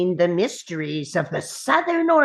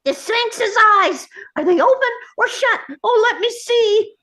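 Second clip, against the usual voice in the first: high, urgent questioning; frantic impatience; 10 semitones higher, 4 dB louder, faster than usual. Acted. Gnome demanding news of the sphinxes from the boy, frantic. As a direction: pester in a frantic, raised pitch.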